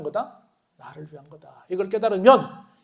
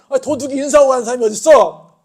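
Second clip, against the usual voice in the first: arguing; 7 semitones higher, 13 dB louder than usual. Acted. Policeman argues back.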